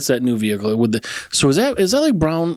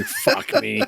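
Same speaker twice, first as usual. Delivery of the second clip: mock shocked curse. Imagined shock as passenger.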